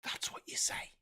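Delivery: hushed, pointed whisper. Shown as a demonstration. Coaching politicians after his tirade, pointed whisper. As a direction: whisper it as an emphatic aside. Aside after demonstrating the emotive line.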